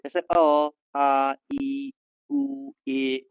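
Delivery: drawn-out open vowels; vowel demo. Shown as a demonstration. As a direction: sing each vowel out clearly for the class. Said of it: "ओ बोलते समय हवा बिना रुकावट से बहती है". (खुले स्वर साफ़ खींचकर)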